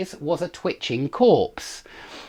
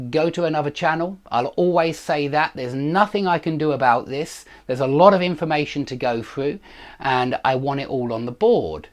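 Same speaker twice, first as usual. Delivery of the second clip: ordinary talk, nothing marked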